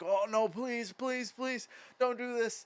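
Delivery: high-pitched imitated begging, a teen pleading with his parents; desperate pleading. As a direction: beg them, voice pushed way up. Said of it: "'cause I'm like".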